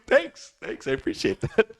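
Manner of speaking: mock bashful thanks. Bashful reply to the praise.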